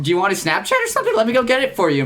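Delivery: rushed, very high imitated girl; eager mock offer. Imitating the girl offering a guy his Snapchat, high and rushed eagerness. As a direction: gush it quickly, way up high.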